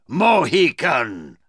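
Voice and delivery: slow, drawn-out villain's address; scornful villain. Acted. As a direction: stretch the word out with scorn. Magua mocks Uncas.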